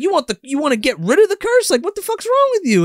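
host voicing Fixer's disbelief at the boy; mock incredulous. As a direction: squeal it in mock shock.

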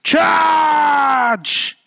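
long, high yelled charge; drawn-out high war shout; battle cry. A narrator's reading. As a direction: yell it like leading an army. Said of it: "came a voice".

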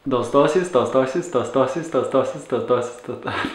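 rapid-fire tongue twister; quick repeats tumbling down towards a laugh; five times fast. Shown as a demonstration. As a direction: rattle off "dos dosis" without stopping. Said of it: "Say that five times fast".

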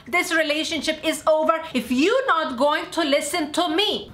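ordinary talk, nothing marked

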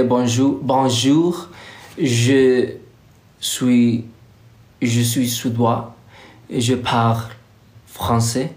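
beginner French phrases said proudly, a hint of French accent; show-off French. Shown as a demonstration. Vlogger showing off schoolbook French to her followers. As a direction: show off your little French to viewers. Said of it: "that's my French".

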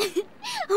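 a bright, high burst of laughter; amused laugh. Acted. Student teacher laughs at the boys' comedy act, amused. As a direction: laugh lightly, charmed by the kids.

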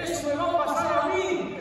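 high, loud shocked outcry; sudden fearful realisation; alarmed cry. Retold or imitated. Oscar seeing the crucifix and fearing the same fate, shouted.

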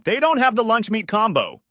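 loud, high holler to a distant friend; high-pitched shout across the room; yelling. A narrator's reading. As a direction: repeat it at a yell. Repeats yell to friend fifteen feet away.

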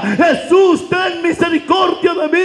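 high, desperate shout; crying out. Acted. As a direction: call out to be heard over the crowd. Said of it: "En medio de su ceguera decía". (el ciego clama a gritos a Jesús)

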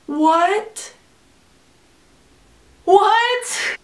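high, rising incredulous "what"; shocked disbelief. Retold or imitated. Student learning she yelled the wrong word, high shocked disbelief. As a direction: escalate from stunned to squeaky disbelief.

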